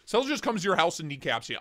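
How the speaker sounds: ordinary delivery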